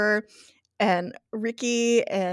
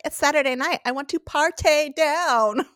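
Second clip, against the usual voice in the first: mock party-girl voice, higher, dragging out the end; party-hungry whine; 5 semitones higher than usual. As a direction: imitate someone itching to party. Quoting party-hungry character, drawn out.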